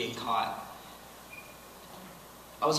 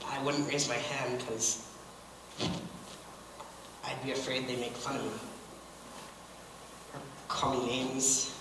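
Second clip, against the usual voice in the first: subdued confession; 8 dB quieter than usual. Acted. Dancer recalling being afraid at school, subdued and halting.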